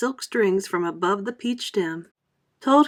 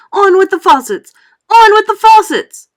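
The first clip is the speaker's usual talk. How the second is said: louder and higher each time; booming shout, building; 11 semitones higher, 15 dB louder than usual. A narrator's reading. Cloud voice ordering the deluge, building shout. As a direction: bellow it again, bigger. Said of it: "it shouted".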